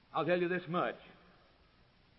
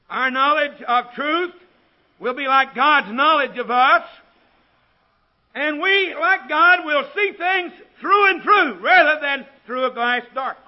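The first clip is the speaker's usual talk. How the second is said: loud, high preacher's proclamation; ringing pulpit declaration at full volume; full-force preaching; 10 semitones higher, 16 dB louder, slower than usual. Real, not acted.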